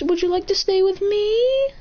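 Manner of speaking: high, slow, gentle invitation; coaxing baby talk. Acted. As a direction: coax a small animal, pitching up sweetly.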